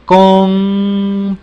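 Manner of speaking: complain, a strong closed-lip m. Shown as a demonstration. Teacher demonstrating the m to the class. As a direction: complain said loud, m with lips pressed shut. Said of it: "que era con los labios cerrados".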